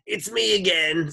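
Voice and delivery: high, loud cartoon lady voice; sloshy lunch lady. A narrator's reading. Lunch lady calls through the door, high sloshy holler. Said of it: "like two boots sloshing through the mud".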